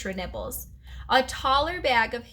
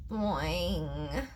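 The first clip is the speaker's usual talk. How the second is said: low, soft, drawn-out boing; very weak frog croak; 6 semitones lower, 6 dB quieter than usual. A narrator's reading. Starving frog answering the hamster, very weakly. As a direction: let the frog's boing sag, low and feeble. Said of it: "Frog sounded even weaker this time".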